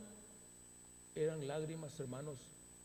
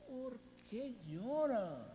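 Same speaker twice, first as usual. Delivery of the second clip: slow rebuke; 7 semitones higher, slower than usual. Shown as a demonstration. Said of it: "Esa es la misma pregunta".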